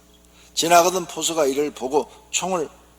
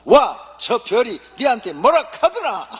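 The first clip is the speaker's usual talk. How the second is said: much higher and louder Gyeongsang retort, half laughing; gruff Gyeongsang husband; 11 semitones higher, 8 dB louder, slower than usual. Acted. Gyeongsang husband brushing off his wife, loud dialect jab. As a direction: bark it back in thick Gyeongsang dialect. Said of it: "경상도 남편이".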